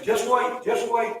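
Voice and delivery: high, insistent voice from above; little heavenly voice. Acted. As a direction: repeat it as a high-pitched plea. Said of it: "this little voice from heaven saying".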